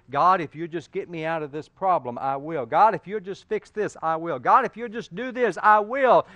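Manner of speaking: loud, rising pleas to God; mock desperate bargaining with God; pleading bargain prayer. Congregant's repeated bargaining prayer.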